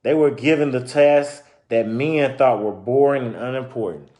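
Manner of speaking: story narration. Acted. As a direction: clear read-aloud storytelling voice. Reading picture book aloud.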